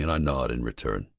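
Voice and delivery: ordinary delivery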